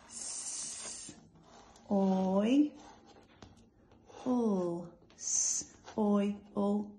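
each sound said apart, slow and separate; segmented phonics sounds; sounding out letters. Shown as a demonstration. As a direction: break the word into its sounds. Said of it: "So let's sound it out".

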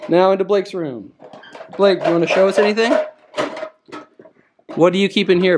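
ordinary talk

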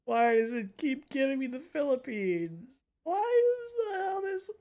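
whiny complaint. Real, not acted. Frustrated at repeat drops.

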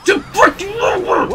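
high, strained outburst; frustrated yelp. Real, not acted. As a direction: squeal it in frustration.

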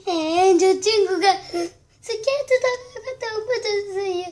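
loud, high tearful voice; upset loud report. Pretend. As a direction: cry out for help. Kid reports friend's fall.

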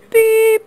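a high, loud imitated "Beep"; mock beep. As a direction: squeak the tone out like the machine. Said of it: "after the tone".

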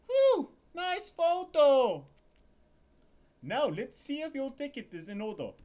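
high, loud fussy purser; a high-strung purser griping; ship purser voice. Acted. Purser checking Larry's ticket, high and loud.

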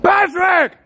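high-pitched scream of a name; sudden high mock-mad scream. Shown as a demonstration. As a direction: scream it out down the street. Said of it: "walk down the street and scream".

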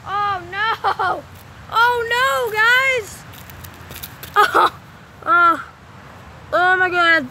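high, loud cries of alarm; dismayed shout. Real, not acted. Vlogger shouting to viewers as ball sticks, loud dismay. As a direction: yell it as the ball gets stuck.

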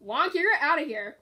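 ordinary talk

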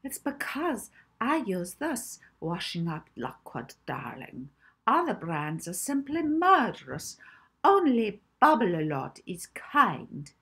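slow, hammed-up commercial lady; mock TV advert for washing-up liquid; whimsical ad voice. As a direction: sell it like a cheesy advert. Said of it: "Molly replied whimsically".

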